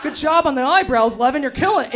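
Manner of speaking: pep-talk herself in the mirror, half mocking; mock affirmation. Herself in the mirror, a mock cheery affirmation. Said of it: "say five nice things about myself".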